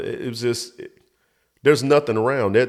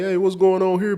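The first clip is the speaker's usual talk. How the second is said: much higher mock confrontation; retold angry challenge; 11 semitones higher, 4 dB louder than usual. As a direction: imitate an indignant man calling out, high. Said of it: "he was cursing or whatever".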